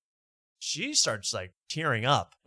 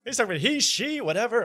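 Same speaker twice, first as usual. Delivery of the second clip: high-pitched dismissive mimicry; exaggerated high, flustered imitation; Jeff Dye impression; 11 semitones higher, faster than usual. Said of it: "Yeah, he does this".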